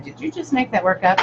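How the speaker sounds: retold question. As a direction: ask it high, retelling the exchange. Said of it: "I said".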